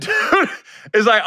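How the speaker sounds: ordinary delivery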